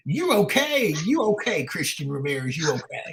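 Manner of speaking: high, stagey voice of an official giving the all-clear; mock official voice. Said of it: "use exactly that voice".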